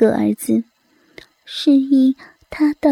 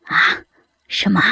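surprised; 6 semitones lower than usual. A narrator's reading. Son startled by news.

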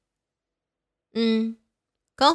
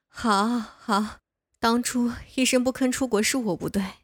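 normal talking voice

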